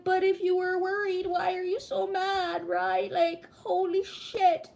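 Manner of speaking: put-on whiny kid. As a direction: plead back at mom like a hurt six-year-old. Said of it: "And it's like".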